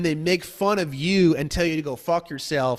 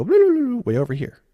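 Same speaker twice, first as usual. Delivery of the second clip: much higher, a squeaky babble; mock rambling client; 8 semitones higher, 5 dB louder, faster than usual. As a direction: gabble off-topic nonsense.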